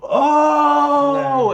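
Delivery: high, loud mock gasp; hammed-up astonished "Oh!"; imitating Bailey's reactions, high and loud; over-the-top reaction. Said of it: "responding so over the top".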